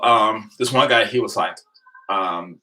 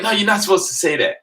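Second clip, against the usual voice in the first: exasperated correction; 12 semitones higher than usual. Retold or imitated. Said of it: "I'm like".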